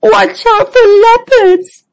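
high whimpered warning; a scared little zebra whimpering. Acted. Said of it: "whimpered Zoe".

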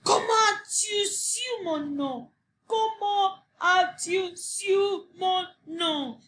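high, shrieking rage; the angry little man screaming at Sophie; furious shout. A narrator's reading. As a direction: scream it, stamping in fury. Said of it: "Il hurla et cria et tapa des pieds".

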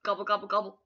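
loud gobbling noise; loud eating noise demo; gobbling sound. Shown as a demonstration. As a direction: gobble it up greedily. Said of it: "Where's your gobble sound?".

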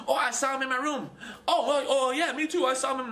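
high, laughing, giddy voice; high, giggly mock believer. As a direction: play it silly and giddy, laughing through it. Mocking a lone private sighting.

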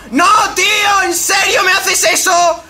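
very high, loud yelling no's; real rage, slowing into disbelief; furious scream. Real, not acted. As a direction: player screaming at an opponent who rammed him. Hit by opponent at race end.

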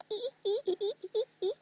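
high squeaky dolphin calls imitated; dolphin squeaks. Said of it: "It goes".

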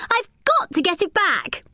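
put-on determined girl. A narrator's reading. Distraught girl after lost hat.

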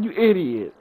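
put-on scornful voice; mock insult. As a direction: sneer it at the teammate. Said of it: "I'm like".

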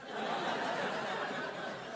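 quiet airy chuckle after the joke; soft breathy laugh. Real, not acted. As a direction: let a silent laugh escape. Laughing at triple oxymoron.

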